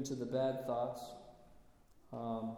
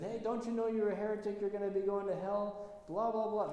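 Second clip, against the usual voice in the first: higher, preachy mock scolding; scolding disciples; 5 semitones higher than usual. Disciples scolding the pagan priest as a heretic, higher mock scolding. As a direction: pitch it up and lecture like a self-righteous zealot.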